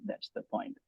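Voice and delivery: ordinary talk, nothing marked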